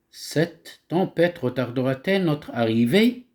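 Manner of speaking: "arrivée" lifted on a clear rising question tune; question intonation. Shown as a demonstration. Teacher reading a question aloud to show the rising tune. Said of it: "Là, regardez l'intonation, comment je parle".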